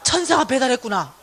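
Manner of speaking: loud laughing realization; laughing exclaim. Retold or imitated. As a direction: burst out in delighted wonder. 깨달으며 외침. (천사가 배달했다고 깨닫고 웃으며 외치는 소리)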